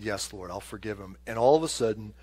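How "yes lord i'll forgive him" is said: humbled surrender. Retold or imitated. Humbled man yields to God.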